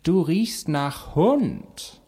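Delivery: high, very drawn-out disdain; haughty talking cat. Acted. Cat complains to dog about the smell, haughty. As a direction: stretch it out with swooping feline snootiness.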